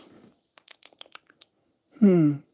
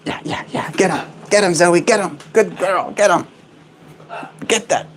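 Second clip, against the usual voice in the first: high cheering; 6 semitones higher than usual. Real, not acted. Cheering bird on to eat.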